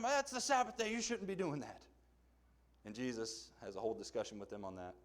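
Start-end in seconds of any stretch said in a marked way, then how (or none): 0.0-1.8 higher, mock-prim rebuke; scolding Pharisees. Pharisees scolding Jesus for eating grain, prim mock rebuke. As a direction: play the fussy Pharisee objecting.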